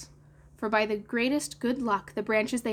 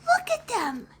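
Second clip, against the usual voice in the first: very high put-on child voice; high-pitched boyish exclamation; crying out; 4 dB louder than usual. A narrator's reading. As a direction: squeal it out in amazement, falling at the end. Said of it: "he cried".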